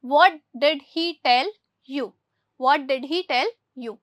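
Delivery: very slow, word by word, for the class; slow dictation. Shown as a demonstration. Teacher reads the English example to pupils very slowly. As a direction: spell out every word for Hindi-speaking pupils. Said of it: "teacher reads the corrected sentence".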